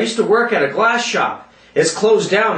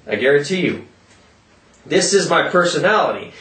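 ordinary delivery

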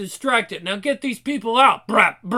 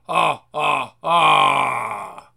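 ordinary delivery

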